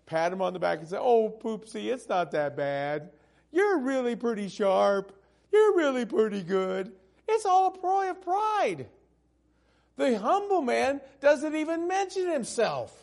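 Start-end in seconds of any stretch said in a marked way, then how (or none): 1.0-6.9 high, loud sugary mock praise; mock flattery. Preacher mocking a flatterer, high and loud. 7.3-12.9 high, loud preaching at full force. Acted. Preacher warning the congregation, high and loud.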